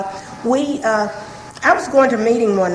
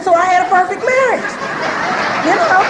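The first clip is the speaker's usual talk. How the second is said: laughing; 7 semitones higher than usual. Real, not acted. Laughs at her perfect marriage claim.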